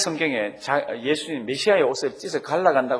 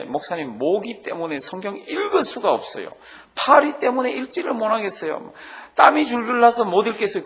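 mock whiny complaint; 6 semitones higher than usual. Voiced congregant complaining to pastor.

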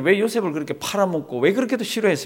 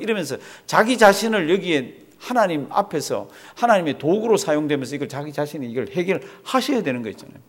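usual speaking voice

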